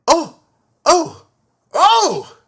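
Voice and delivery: short, high, loud ohs; startled yelps. A narrator's reading. Porters jumping out of the way, startled high yelps. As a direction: yelp in alarm, each oh sharper. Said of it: "The porters went".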